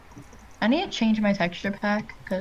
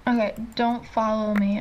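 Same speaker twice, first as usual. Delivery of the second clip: usual speaking voice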